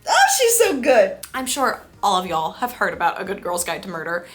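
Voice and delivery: ordinary delivery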